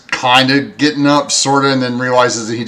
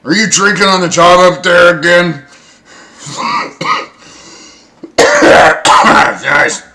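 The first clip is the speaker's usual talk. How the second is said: loud, raised-pitch telling-off; woken bosun scolding; 7 semitones higher, 10 dB louder than usual. Acted. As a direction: holler up at a slacking crewman. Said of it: "kinda wake up and go".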